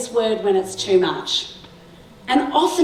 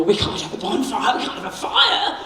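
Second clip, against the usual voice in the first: panicky parents. Retold or imitated. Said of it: "And all these parents were".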